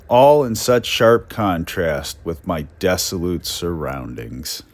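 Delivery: reading an old letter aloud with mock pathos; dramatic reading. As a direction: give the lonely railroad clerk's words their full gloom.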